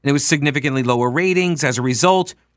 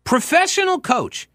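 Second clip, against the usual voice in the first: high-pitched disbelief; scandalized high exclamation; incredulous repeat; 13 semitones higher than usual. Real, not acted. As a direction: echo it way up high, astonished.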